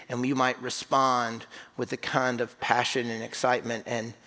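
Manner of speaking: low, subdued pastoral prayer; leading prayer. Real, not acted. Leads the congregation in prayer.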